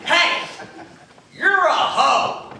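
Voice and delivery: loud high mock catcaller shout; hammed-up street heckler; catcaller yell. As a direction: yell it from a passing car. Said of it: "and he goes".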